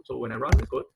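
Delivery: ordinary talk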